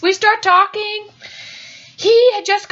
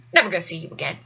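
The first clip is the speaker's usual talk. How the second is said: low, soft dismissal; low offhand undertone; private self-reassurance; 13 semitones lower, 6 dB quieter, much faster than usual. Retold or imitated. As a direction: toss it off quietly to yourself. Reassuring herself, first take.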